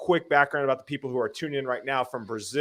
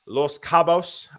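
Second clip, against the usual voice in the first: mock Spanish accent; 3 semitones higher, 4 dB louder, much slower than usual. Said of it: "I think that was my best Spanish accent".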